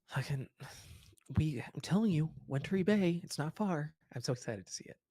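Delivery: high, gushing little halfling; excited girl voice. Acted. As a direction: pitch up, bubbling with excitement. GM voicing Bonnie again.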